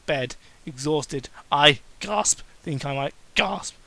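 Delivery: ordinary talk, nothing marked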